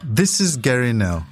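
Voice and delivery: ordinary delivery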